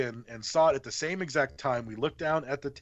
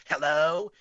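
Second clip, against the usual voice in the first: drawn-out mocking hello, slightly louder than plain; mocking echo; 2 semitones higher, 3 dB louder than usual. Eerie phone voice echoes the caller's greeting, mocking. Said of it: "but it was mocking me".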